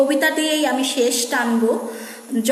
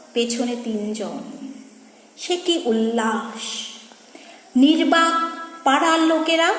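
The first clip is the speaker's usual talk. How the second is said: poem recitation. Acted. Reciter telling of silent neighbours, dramatic recitation. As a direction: recite with weighty pauses.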